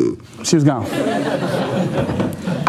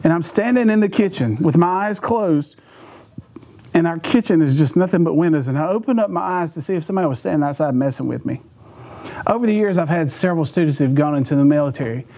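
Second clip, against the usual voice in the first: ordinary delivery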